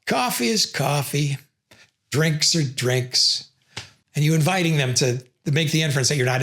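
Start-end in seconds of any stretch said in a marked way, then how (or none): 0.0-3.4 slow, deadpan shrug of a line; coffee is coffee, said slowly and pointedly; dismissive tautology. Shown as a demonstration. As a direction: drag it out flatly, closing the topic. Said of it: "So something like".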